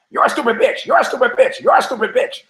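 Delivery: very high parrot squawk; high-pitched parrot voice. Acted. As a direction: screech it out like a talking parrot. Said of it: "The parrot goes".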